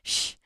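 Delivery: loud, sharp shush; urgent shush. A narrator's reading. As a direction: hush someone urgently. Mud hushes Hera's shrill outburst.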